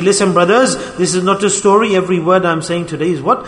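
normal talking voice

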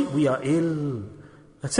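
soft, slow, grave admission of illness. Real, not acted. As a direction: let the verdict drop quietly and slow. Grave sermon conclusion.

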